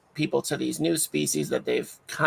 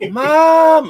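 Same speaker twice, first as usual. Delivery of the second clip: put-on loud, high, drawn-out kid's cry of "Mom"; excited kid; 13 semitones higher, 15 dB louder than usual. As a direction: call out to mom like an overexcited child. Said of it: "They knew I'd be like".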